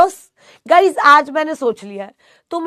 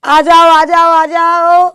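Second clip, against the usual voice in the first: loud welcoming call; 2 semitones higher, 11 dB louder, much slower than usual. Real, not acted. Teacher loudly calling her students to come in. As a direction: call them in, loud and bright.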